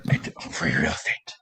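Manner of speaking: low, slow, whispered meme quote; deep meme voice. Retold or imitated. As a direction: growl the meme line low.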